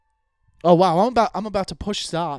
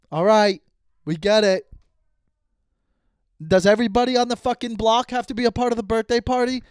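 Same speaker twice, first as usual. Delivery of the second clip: raised, exasperated complaint; fed up, pitch climbing high; annoyed; 5 semitones higher, 4 dB louder than usual. Real, not acted. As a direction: gripe loudly at the partying neighbors. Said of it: "I'm still kind of annoyed with it".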